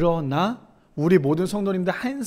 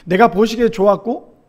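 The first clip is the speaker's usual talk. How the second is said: ordinary delivery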